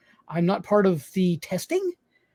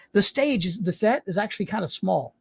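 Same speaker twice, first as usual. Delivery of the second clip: normal talking voice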